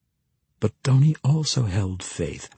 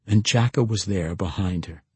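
ordinary delivery

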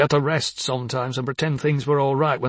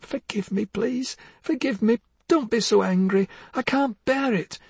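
high, tearful pleading, slowing down; weeping wife begging forgiveness; crying plea; 7 semitones higher than usual. Acted. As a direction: beg through tears. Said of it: "Oh, do stop crying".

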